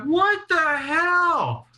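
very high, drawn-out cry of disbelief; outraged yell. Retold or imitated. Tased teen rolls over at the cop, outraged yell. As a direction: stretch it out high in mock outrage. Outburst after being tased.

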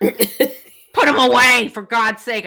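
loud, high, drawn-out exasperated command; urgent order. Retold or imitated. Telling two women to hide the dolls, urgent order. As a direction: re-enact a frantic telling-off.

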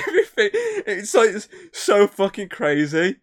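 can barely get it out for laughing. Real, not acted. Cracking up over the movie's wild plot, laughing.

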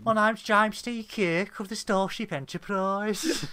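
hammed-up Birmingham Kirk; Birmingham accent. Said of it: "who are just from Birmingham".

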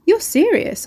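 as if she can't believe her ears; woman doubting a stranger's odd advice, incredulous. A narrator's reading.

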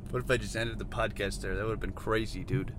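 low, soft afterthought; subdued aside. Real, not acted. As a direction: drop right down, musing quietly. Drops voice after mock ending.

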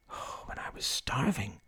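hushed, whispery musing; private thought. Acted. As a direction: think it aloud almost in a whisper. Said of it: "she thought".

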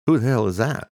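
can't believe what he heard; incredulous. Acted. Eamon's incredulous question.

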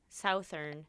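Southern as SOW-thern, south then ern; Southern with the vowel of south, SOW-thern; south plus ern. Shown as a demonstration. Showing her friend the odd pronunciation of southern. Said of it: "ern" onto south".

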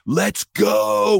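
rider's aggressive text read out, mock pushy; mock aggressive demand. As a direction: bark the text like an angry rider. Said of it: "it's getting really aggressive".

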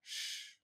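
soft unvoiced shh; a quick whispered shush to a heckler; retold shush. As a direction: hush someone sharply under your breath. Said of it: "and, like, shushed him".

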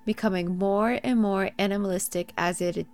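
spooky story narration. Acted. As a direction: read it like a campfire tale. Reads her scary story.

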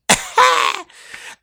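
very high, loud "HA"; mocking laugh. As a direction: blast one piercing, taunting laugh. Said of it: "I just point at her like".

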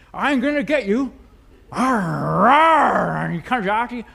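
loud, slow hammed-up threat; mock menacing devil. Preacher mocking a disarmed Satan, hammed-up loud slow growl. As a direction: ham up a toothless lion's threat. Satan voiced as harmless roaring.

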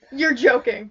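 usual speaking voice

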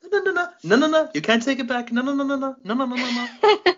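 high, loud string of rapid refusals; playful rapid protest. Real, not acted. Host refusing to let co-host backtrack, playful high protest. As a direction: cut them off, high-pitched and insistent.

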